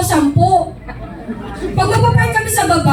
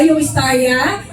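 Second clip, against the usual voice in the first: usual speaking voice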